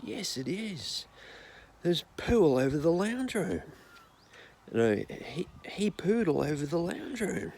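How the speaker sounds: old nana impression. Eighty-five-year-old nana at dinner, high and hammed-up. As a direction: wail about the poo like a shocked nana. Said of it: "The nana goes".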